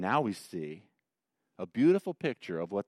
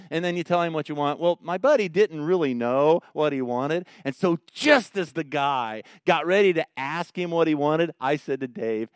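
ordinary delivery

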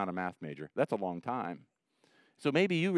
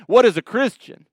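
loud high preacher question; animated pastor shouting to the crowd; preacher's shout; 7 semitones higher, 11 dB louder than usual. Retold or imitated. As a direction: shout it from the pulpit. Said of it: "he got really animated".

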